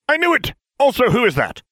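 high, loud triumphant cry; excited exclamation. Acted. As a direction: blurt it out triumphantly.

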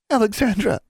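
high, urgent name call; startled, high-pitched alarm; alarmed cry. A narrator's reading. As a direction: cry out as your friend falls. Catching her falling friend.

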